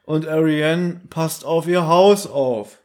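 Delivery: slow, drawn-out porter impersonation; imitating the porter. Host mimicking the porter explaining where Mrs. Fowler is, stilted. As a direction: stretch every word like a stilted radio-play actor. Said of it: "dem Fördner nachmachen".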